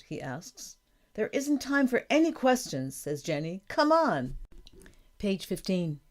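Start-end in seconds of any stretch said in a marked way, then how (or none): 1.1-3.0 high-pitched, hurrying insistence; urgent, eager. A narrator's reading. Daughter hurrying her father, urgent and eager. 3.7-4.4 high, impatient call; urgent, eager. A narrator's reading. Daughter pulling her father away, eager.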